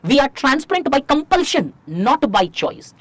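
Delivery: very slow, stressing each word; slow dictation. Shown as a demonstration. Teacher telling students to underline it, slow dictation. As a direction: slowly lay down the key line to underline. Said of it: "ये underline से लिखना".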